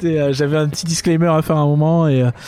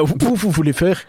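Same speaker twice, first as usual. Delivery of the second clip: usual speaking voice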